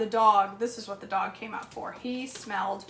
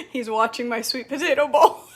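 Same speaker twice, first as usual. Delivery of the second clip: laughing through the line, louder; 2 semitones higher, 9 dB louder than usual. Real, not acted. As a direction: giggle at the dog staring at your bowl. Laughing at the begging dog.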